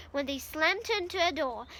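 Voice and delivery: plain reading voice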